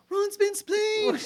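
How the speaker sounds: high, drawn-out mock British shout; over-the-top British schoolgirl cry; Hermione. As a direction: wail it slowly, hammed-up and pinched. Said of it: "Have you seen her Hermione impression?".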